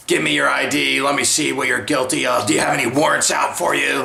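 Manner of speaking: mock curt police officer voice; suspicious cop. Police officer demanding the caller's ID, mocking suspicious voice. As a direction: imitate a cop treating the victim as a suspect.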